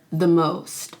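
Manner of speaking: normal talking voice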